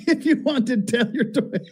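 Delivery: words breaking into giggles; laughing while reading. Real, not acted. Reading the rant aloud to a friend, laughing through the words. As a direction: read it through your laughter, high and amused.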